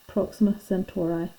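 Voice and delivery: "Centauri" ending in an "eye" sound; "Centauri" with a long i at the end; possibly mistaken pronunciation. Shown as a demonstration. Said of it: "if I'm pronouncing that wrong".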